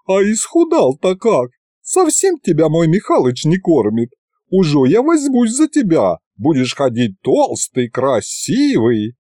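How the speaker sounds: high-pitched doting auntie voice; auntie's high fretting, slowing at the end; fussing doting aunt. Acted. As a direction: fuss over a skinny boy like an aunt.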